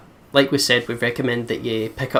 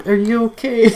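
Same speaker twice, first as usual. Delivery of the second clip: higher, put-on caring neighbor; mock friendly neighbor; 10 semitones higher, 3 dB louder than usual. Imagining a neighbor checking in, mock caring voice. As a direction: ask like an overly nice neighbor.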